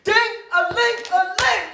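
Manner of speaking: slow, high, loud drawn-out ding; bellowed bell call. Acted. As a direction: strain your throat calling. Straining to call servant.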